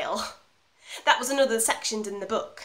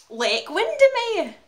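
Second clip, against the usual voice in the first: high, loud, drawn-out flourish; inspired suggestion; 12 semitones higher, 7 dB louder, slower than usual. Acted. Actress pitching a film theme to director, inspired. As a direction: present it as a brilliant idea.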